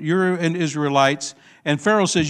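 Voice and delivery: ordinary talk, nothing marked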